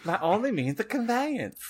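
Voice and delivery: high-pitched, swooping character voice; cartoon character imitation.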